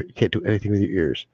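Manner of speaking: ordinary talk, nothing marked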